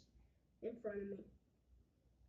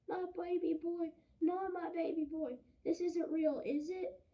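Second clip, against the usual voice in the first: higher, louder, grief-stricken cry of a mother; distraught mother; 7 semitones higher, 7 dB louder than usual. Acted. Mother hearing her son died, distraught and pleading. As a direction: plead that it isn't true.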